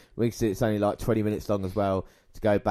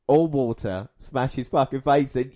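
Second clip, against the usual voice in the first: ordinary talk, nothing marked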